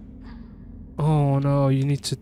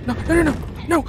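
high, loud frantic denial; panicked screaming no; 13 semitones higher, 5 dB louder, much faster than usual. Real, not acted. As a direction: scream as it gets you. Caught by monster, panicking.

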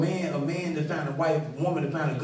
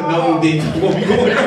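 loud mock refusal, laughing through it; 9 dB louder than usual. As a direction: turn the church invite down out loud. Refusing a friend's church.

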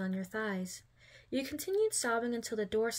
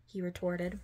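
usual speaking voice

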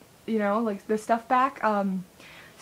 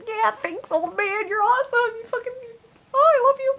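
very high, squealing gush; so excited; 12 semitones higher, 4 dB louder than usual. Retold or imitated. Kid thanking man for compliment, so excited. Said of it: "I was, like, so excited".

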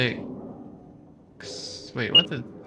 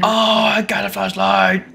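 excited; 6 semitones higher than usual. Real, not acted. Finds item in game.

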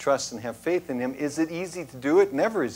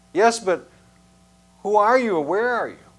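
higher and louder, bewildered; startled question; 7 semitones higher, 5 dB louder than usual. Acted. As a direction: call out, confused at the voice. Jack asking unseen voice.

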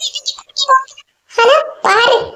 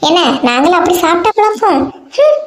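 usual speaking voice

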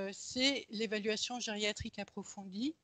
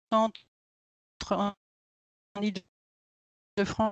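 voix hachée, mots isolés; son saccadé; 8 dB louder than usual. Real, not acted. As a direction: connexion qui coupe la parole. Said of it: "ne nous permet pas de suivre". (son coupé par une mauvaise connexion)

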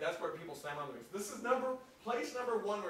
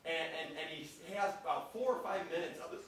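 normal talking voice

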